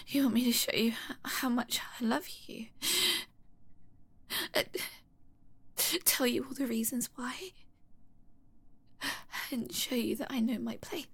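soft, hushed, barely voiced; submissive whisper. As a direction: whisper it meekly.

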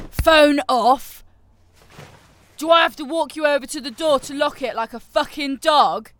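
loud, high barked order; furious shout. Acted. Captor shouting at the captive to switch off the phone. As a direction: yell the order in rage.

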